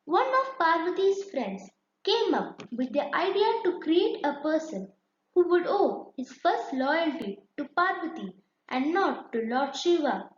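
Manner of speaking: usual speaking voice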